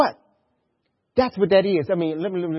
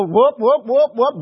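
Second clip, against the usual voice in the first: loud, high warning whoops; mock siren going off; imitated alarm whoops; 6 semitones higher, 8 dB louder, slower than usual. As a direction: sound the alarm in quick repeated whoops. Imitates inner warning alarm at worry.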